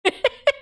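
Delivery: short high giggle; a quick real laugh; bright burst of laughter. Real, not acted. Laughing at own story.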